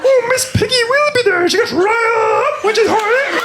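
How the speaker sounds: very high, loud squealing diva; Miss Piggy voice. Retold or imitated. Miss Piggy squealing about herself to a date, very high. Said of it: "I'll do stupid voices".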